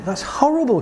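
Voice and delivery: high, hurt protest; upset client. Retold or imitated.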